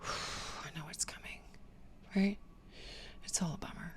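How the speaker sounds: sighing, hushed dread; weary whisper. Pretend. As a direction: sigh it out as the dreaded visit nears. Imitating dread before visit.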